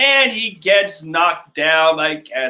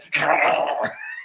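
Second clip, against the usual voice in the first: bubbling gurgle; 5 dB quieter than usual. Retold or imitated. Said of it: "as he says".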